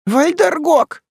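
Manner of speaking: high, loud cry of dread; frightened, high-pitched exclamation; alarmed woman. A narrator's reading. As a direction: gasp the name out in a high woman's voice. Маас в страхе крестится, повторяя название.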